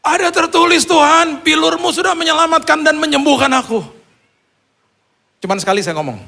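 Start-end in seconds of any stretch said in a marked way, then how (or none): 0.0-3.8 very high, strained cry to God; full-voiced retold yell, pitched way up; desperate shout. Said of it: "Saya teriak".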